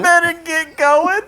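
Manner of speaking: high, drawn-out mimicry; slow, high-pitched mock quote; playful movie quote. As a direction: stretch it out high and loud, hamming up the line. Echoes the film line, laughing.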